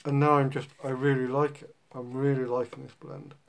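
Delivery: talking around pipe stem; pipe in mouth. Real, not acted. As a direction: teeth clenched on pipe. Seen 3: Pipe clamped in his teeth throughout the labelled words, smoke rising.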